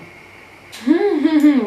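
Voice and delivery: loud high burst of laughter. Real, not acted. Laughing at her failed liner.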